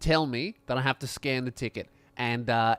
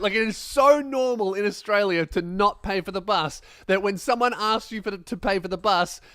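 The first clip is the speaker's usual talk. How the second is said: ordinary talk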